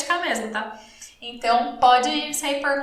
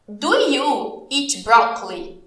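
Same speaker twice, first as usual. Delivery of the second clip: very slow, spaced-out English question; very slow model question; 2 semitones lower, much slower than usual. Shown as a demonstration. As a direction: ask it slowly, chunk by chunk. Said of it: "Eu vou te perguntar aqui". (pergunta-modelo bem devagar, pausada)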